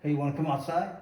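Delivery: ordinary delivery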